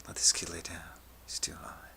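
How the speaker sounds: soft, half-whispered instruction; hushed counselor. Retold or imitated. Counselor quietly telling nurse about student, hushed voice. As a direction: say it low and discreet.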